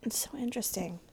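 soft unvoiced whisper. Real, not acted. Quiet aside at end.

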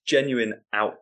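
ordinary talk

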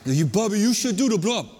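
punchy put-on Hawaiian accent. Counselor urging a kid onto the blob, Hawaiian accent. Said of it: "in this Hawaiian accent".

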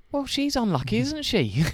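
high, loud sarcastic comeback; mock retort. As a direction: throw back an incredulous jibe. Comeback acted to drunk man.

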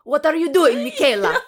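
put-on a failed Russian accent that comes out Italian; Russian accent drifting into Italian; Italian-sounding accent. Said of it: "That's kind of Italian".

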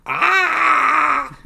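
a sudden high shout of pain; pained scream. Retold or imitated. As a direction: yelp as if struck from behind. Cry as blood flies.

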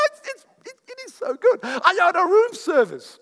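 squealing high and loud with joy; gushing delight. Real, not acted. Preacher telling the church how he loves hotel rooms, squealing with delight. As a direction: gush like it's the best thing ever.

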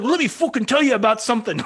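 put-on high, fast, hot-tempered Canadian accent; exaggerated Canadian accent, pitched high; thick Canadian accent. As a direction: imitate a riled-up Canadian with a heavy accent. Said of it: "thickest Canadian accent I've ever heard".

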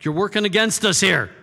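a prophet scolding God, indignant and loud; mad at God. Acted. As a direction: rant at God like a sulking prophet. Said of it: "he's mad at God".

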